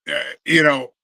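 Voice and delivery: normal talking voice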